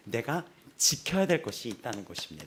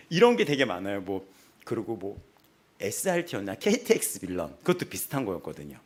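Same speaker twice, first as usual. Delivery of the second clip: usual speaking voice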